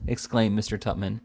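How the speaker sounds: ordinary delivery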